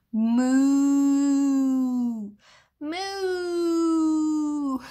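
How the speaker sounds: long, loud, drawn-out moo; cow moo. Pretend. Storyteller answering what a cow says, long loud mooing. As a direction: imitate a cow with big sustained moos. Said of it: "A cow says".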